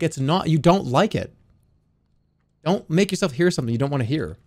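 normal talking voice

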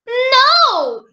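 put-on high, loud childish no; swooping high storybook shout; princess's shout. A narrator's reading. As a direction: shout a denial like a small girl. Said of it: "shouted the little princess".

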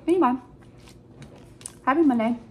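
talking while chewing; muffled around food; mouth full. Real, not acted. Seen 3: Bite taken at -3; cheeks full, chewing while speaking.

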